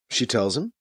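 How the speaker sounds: plain reading voice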